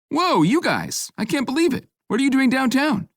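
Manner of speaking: high, bright-eyed gushing greeting; excited friend. A narrator's reading. Reggie thrilled to bump into friends, high excited voice. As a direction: squeal with delight at seeing friends.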